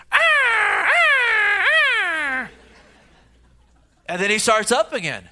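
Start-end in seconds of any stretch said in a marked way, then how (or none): 0.1-2.5 high, loud, broken screeching cries; a stuttering run of shrieks; choppy owl screeches. Retold or imitated. Pastor imitating the owl's occasional burst, loud high choppy screeches. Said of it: "Except occasionally it's".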